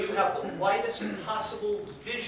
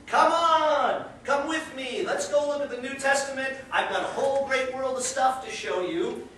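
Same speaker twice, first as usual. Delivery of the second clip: loud, high-pitched beckoning; rallying call; 6 semitones higher, 4 dB louder than usual. Acted. Sea frog calls all the frogs to come, excited rallying. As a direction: call them along with eager urgency.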